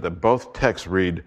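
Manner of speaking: usual speaking voice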